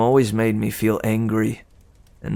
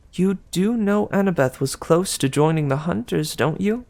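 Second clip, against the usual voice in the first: higher, sweet gossiping goddess; Aphrodite; 7 semitones higher than usual. Acted. Aphrodite teasing Percy about Annabeth, higher and sweet. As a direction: coo it like a doting goddess.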